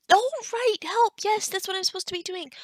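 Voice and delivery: sudden loud, high, fast remembering; high startled start, then hurried; flustered realisation. Acted. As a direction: burst out as the task comes back to you. Suddenly remembers she should be helping.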